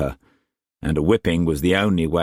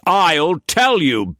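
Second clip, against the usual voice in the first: higher, louder raging master's voice; furious master; 11 semitones higher, 4 dB louder, slower than usual. A narrator's reading. Struan raging at Mei Mei before whipping her, furious shout. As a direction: bark orders like an enraged lord.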